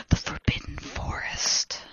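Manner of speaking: hushed, spooky whisper; breath-only ominous reveal. A narrator's reading. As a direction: whisper the name for dramatic effect. Ominous place named in narration.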